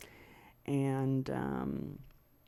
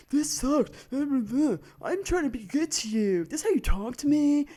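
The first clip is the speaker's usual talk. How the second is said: high, loud mock complaining; mocking whiny imitation; 11 semitones higher, 9 dB louder than usual. Ex mimicking his gaslighting complaint, loud and mocking. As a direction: imitate him in an exaggerated, high-pitched wail.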